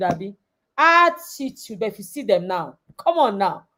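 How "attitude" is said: sassy attitude. Shown as a demonstration. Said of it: "You know attitude".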